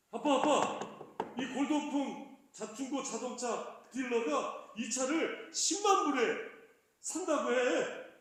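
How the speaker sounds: retelling the son bursting in thrilled; excited son. Retold or imitated. Son rushes home to tell his dad about the big offer, excitedly. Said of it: "얼굴이 막 상기돼가지고 흥분해서".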